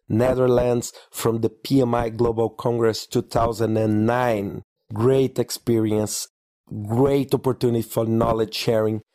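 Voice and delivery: usual speaking voice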